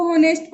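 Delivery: होनेस्ट with the h pronounced; होनेस्ट as spelt, starting on h; sounded H. Shown as a demonstration. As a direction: mistakenly breathe an h onto होनेस्ट. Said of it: "नहीं".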